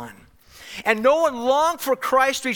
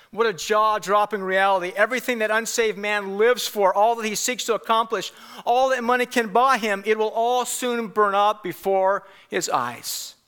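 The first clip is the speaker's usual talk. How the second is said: usual speaking voice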